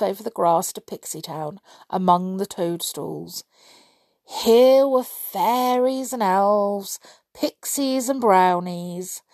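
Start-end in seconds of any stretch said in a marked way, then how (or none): none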